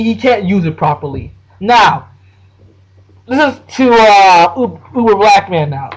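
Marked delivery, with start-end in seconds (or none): none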